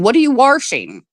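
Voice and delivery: imitating "washing" said as "warshing", an R slipped in; mock the up-east "warshing" with an added R; intrusive R accent. Mocking how people up east ask about laundry, with an intrusive R. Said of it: "They add R's to shit".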